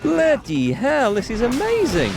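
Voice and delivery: slow, drawn-out real amazement; amazed. Real, not acted. Streamer marvelling at the new sword, amazed. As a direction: marvel at it, stretching the words.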